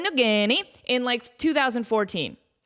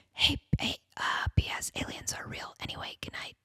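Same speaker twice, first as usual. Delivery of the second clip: hushed unvoiced whisper, very soft; imitating a whisper; 8 dB quieter, faster than usual. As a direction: tiptoe the words out in a whisper. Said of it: "he whispers".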